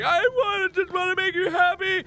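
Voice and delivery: high, loud mock wail; squealed weepy self-parody; hammed-up crying. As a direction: plead tearfully, way over the top. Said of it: "I cry and cry and cry".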